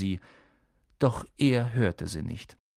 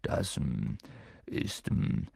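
softer, halting nervous hum; nervous mumble; 10 semitones lower, 7 dB quieter, slower than usual. A narrator's reading. Hagrid caught with the egg, nervous mumble. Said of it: "brummte Hagrid und fummelte nervös".

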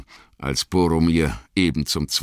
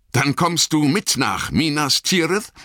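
eager call, slightly raised pitch; gespannter Ausruf; 11 semitones higher, 3 dB louder than usual. A narrator's reading. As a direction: call out in tense, hopeful expectation. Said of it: "rief Boromir mit leuchtenden Augen und gespannter Miene". (gespannt und hoffnungsvoll gerufen)

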